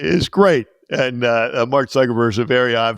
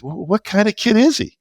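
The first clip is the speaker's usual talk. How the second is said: higher, curious wife's question; imitating the wife; 9 semitones higher than usual. Wife asking the teacher about him, higher. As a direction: ask it up high like the wife. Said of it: "My wife goes".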